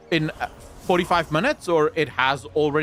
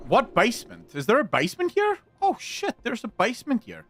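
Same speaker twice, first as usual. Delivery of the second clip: high, amazed discovery; surprised; 8 semitones higher than usual. Real, not acted. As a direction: gasp it out in wonder. Discovering a basement.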